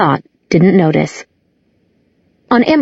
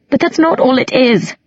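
higher, a touch of sadness; almost sad; 6 semitones higher than usual. A narrator's reading. Isabel answers her brother, a touch sad. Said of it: "Isabel sounded almost sad".

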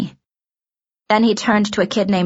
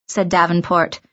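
ordinary delivery, nothing marked

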